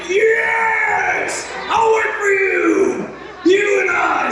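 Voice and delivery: high shouted joy, drawn out; euphoric shout. Retold or imitated. Thanking the husband for milk, ecstatic high shout.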